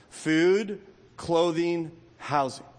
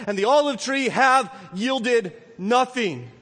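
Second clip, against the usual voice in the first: scripture reading; 5 semitones higher, 3 dB louder than usual. Acted. Pastor reads Haggai to his church, proclaiming. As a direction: proclaim the verses to the congregation.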